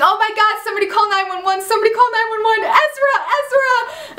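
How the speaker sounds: hammed-up panic, calling for help; mock screaming. The girls around wounded Ezra, high panicked mock screaming. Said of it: "they're all screaming".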